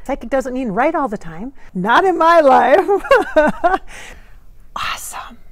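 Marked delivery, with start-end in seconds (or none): 1.7-3.8 slow, loud and higher, drawn out; slow loud emphatic no; emphatic retort. Real, not acted. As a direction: stretch each word out in protest. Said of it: "sassy retort".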